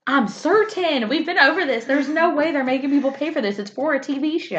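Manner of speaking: high-pitched, emphatic retort; insist, worked up in a playful argument; exasperated insistence. Real, not acted.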